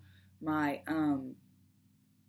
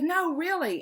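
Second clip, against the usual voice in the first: higher and louder, emphatic; insistent raised voice; 8 semitones higher, 6 dB louder than usual. Retold or imitated. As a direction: insist hard, voice up. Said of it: "you don't have to yell".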